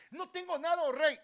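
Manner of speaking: high, very loud acted protest; beggar's outcry. Beggar claiming poverty to the king, crying out. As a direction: cry out empty-handed at full voice.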